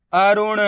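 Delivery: "अरुण" read out as one joined word; अक्षर मिलाकर उच्चारण. Shown as a demonstration. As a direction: blend "अरुण" carefully for learners, sounding the final "ण". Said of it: "इनको आपस में लाके पढ़ना है". ("अरुण" को जोड़कर साफ़ बोलना)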